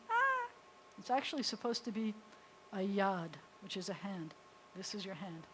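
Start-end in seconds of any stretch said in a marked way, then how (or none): 0.1-0.5 a sudden high "Ah!"; a quick startled cry; surprised. Real, not acted. Teacher startles mid-lesson with a high cry.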